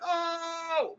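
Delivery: a very high, soft shocked oh; the shock he hides inside; inner shock. Retold or imitated. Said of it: "In my mind, I'm like".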